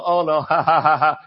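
loud nervous laughing brush-off; forced embarrassed laugh; awkward laugh. Retold or imitated. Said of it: "And you'd go".

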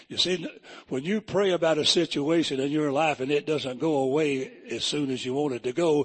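normal talking voice

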